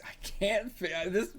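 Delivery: high squeal of real frustration; exasperated. Real, not acted. Reader failing to hold the voice, high exasperation. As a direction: give up mid-word, voice shooting up.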